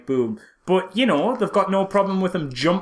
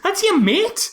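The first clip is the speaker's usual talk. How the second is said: squeaky mock voice; 4 dB louder, slower than usual. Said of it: "It's like".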